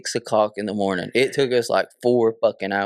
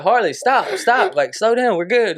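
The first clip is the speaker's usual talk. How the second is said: higher-pitched mock calling after someone; retold calling out; 9 semitones higher, 4 dB louder than usual. Storyteller calls to friend running far ahead, retold urgent call. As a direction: call out to halt a runner, retold.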